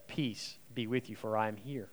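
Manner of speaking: soft, tender blessing; gentle blessing. Acted. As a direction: lay it down softly like a comfort. Jesus' blessing to struggling listeners.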